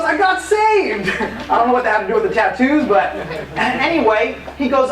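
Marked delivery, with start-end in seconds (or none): 0.0-1.1 high, slow, laughing through the words; old friend sharing good news, high slow and laughing; laughing joy. Retold or imitated. Retelling friend's joyful news.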